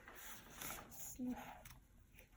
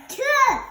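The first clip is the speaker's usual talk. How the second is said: very loud high achoo bursting out; huge sneeze; 8 semitones higher, 15 dB louder than usual. Acted. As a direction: sneeze with everything you have. Gerald sneezes again.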